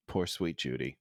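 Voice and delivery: soft, low and pitying. Real, not acted.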